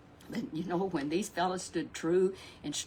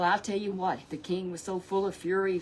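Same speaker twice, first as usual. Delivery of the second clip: ordinary delivery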